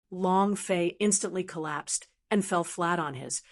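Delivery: ordinary delivery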